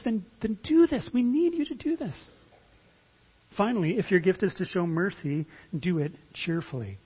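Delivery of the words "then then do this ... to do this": higher, earnest plea as Paul; Paul's call, pitched up and pleading; voicing Paul's urging. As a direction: urge them on like the apostle. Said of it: "Paul says".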